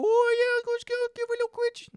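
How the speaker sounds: very high squeaky cartoon fish; little fish voice. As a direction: squeal it like a tiny excited fish. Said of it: "look at a little fish just go".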